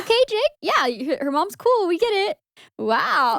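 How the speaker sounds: high, teasing eye-roll; mock exasperation. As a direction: tease him for gushing about her mom. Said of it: "And you're like".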